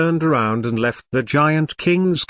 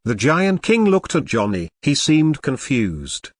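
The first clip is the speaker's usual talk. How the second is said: ordinary delivery, nothing marked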